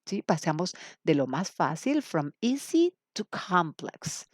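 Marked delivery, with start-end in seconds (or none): 2.0-4.3 slow, deliberate phrase; teacher spelling it out slowly; slow emphasis. Shown as a demonstration. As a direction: lay it out slowly. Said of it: "teacher stresses key phrase".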